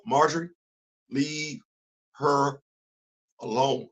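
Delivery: soft and slow, each word spaced; hushed slow warning. Real, not acted. Host warning Marjorie, hushed and spaced. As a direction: lean in and hush it out word by word.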